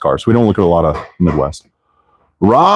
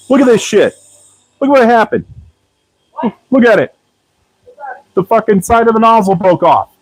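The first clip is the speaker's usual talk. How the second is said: frustrated yell. Real, not acted. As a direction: shout it up high at the broken nozzle. Nozzle snaps, yells in frustration.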